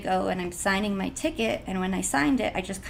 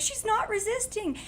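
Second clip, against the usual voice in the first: pleading friends. Retold or imitated. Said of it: "My friends are like".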